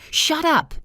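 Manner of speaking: sharp high yell at her. Acted. Father-in-law yelling at the crying wife. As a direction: bark it at her loud and high. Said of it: "he yelled at her".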